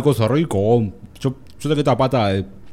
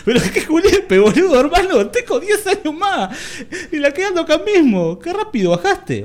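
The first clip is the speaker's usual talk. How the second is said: squealing laugh, much higher; high-pitched laughing shriek; 5 dB louder than usual. Real, not acted. Startled by demonic greeting.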